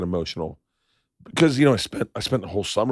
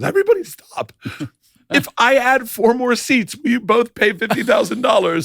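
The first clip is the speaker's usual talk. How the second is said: Sister Donna's voice; 14 semitones higher, 4 dB louder than usual. Retold or imitated. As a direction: mimic a nun halting the room. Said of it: "Sister Donna came in and goes".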